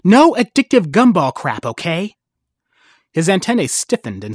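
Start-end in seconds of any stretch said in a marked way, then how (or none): 0.0-2.1 menacing. Acted. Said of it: "pointed a menacing finger".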